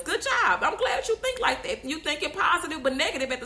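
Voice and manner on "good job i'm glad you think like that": higher, mock-cheery congratulation; mock praise. Speaker answering boastful copycats with fake praise, mock-approving. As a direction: hand out sarcastic praise, pitched up.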